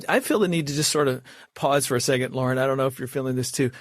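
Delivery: normal talking voice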